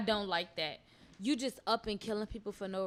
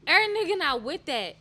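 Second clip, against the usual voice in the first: louder, heated, higher; emphatic loud; 7 semitones higher, 9 dB louder than usual. Real, not acted. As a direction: push it hard and loud. Heated point in a debate.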